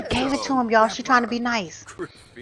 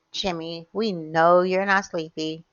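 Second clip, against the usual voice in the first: ordinary delivery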